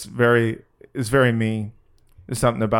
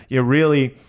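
normal talking voice

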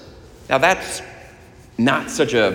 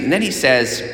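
usual speaking voice

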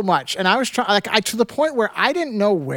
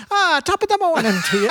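high-pitched mock Irish accent; cartoon Irish greeting, pitched up; 10 semitones higher than usual. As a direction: imitate a comically Irish accent. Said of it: "That guy's Irish accent was hilarious".

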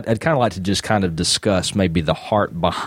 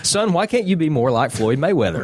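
mock dad; 8 semitones higher than usual. Mock dad lecturing son.

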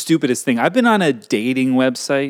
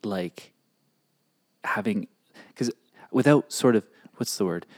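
whispering; 4 semitones lower, 6 dB quieter than usual. Real, not acted. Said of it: "Okay, it's a secret".

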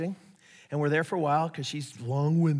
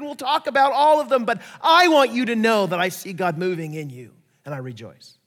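Paul's encouraging charge; 5 semitones higher, 6 dB louder than usual. Retold or imitated. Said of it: "is to say".

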